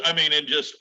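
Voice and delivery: ordinary delivery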